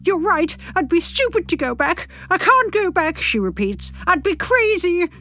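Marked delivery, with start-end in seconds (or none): 0.0-3.2 high-pitched upset character voice; wobbly. A narrator's reading. Sandra gives in to narrator on the phone, wobbly. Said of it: "her tone wobbly". 4.1-5.1 high, slow upset voice; wobbly. A narrator's reading. Sandra repeats herself down the phone, wobbly. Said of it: "her tone wobbly".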